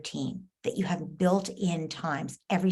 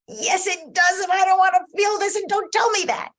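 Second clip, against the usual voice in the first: much higher, louder, fast exasperated cry; child's outburst; 8 dB louder than usual. Retold or imitated. Child rejecting a parent's empathy, loud and exasperated. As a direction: burst out, fed up and hurt. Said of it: "she's like".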